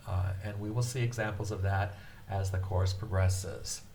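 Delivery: usual speaking voice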